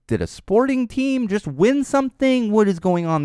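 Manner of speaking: high-pitched incredulous question; mock astonishment; retold bafflement. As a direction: act out baffled disbelief, pitch shooting up. Retelling his baffled reaction to fireworks.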